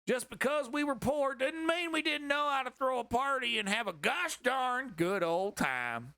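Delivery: high, hammed-up hillbilly storyteller voice; hillbilly reading voice. Comedian mocks a memoir by reading it aloud, high and hammed-up hillbilly. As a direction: read it like a proud country boy bragging. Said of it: "Listen to this".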